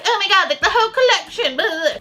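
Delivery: high, airy valley-girl gush mocking princess fans. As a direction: squeal it like a ditzy fan about the collection. Mocking princess fans' gushing.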